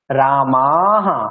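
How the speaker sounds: রামাহা with the aa drawn out slowly and a breathy ha at the end; long aa with visarga. Shown as a demonstration. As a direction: stretch the aa in রামাহা, then add visarga. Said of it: "আকারটা টানতে হবে".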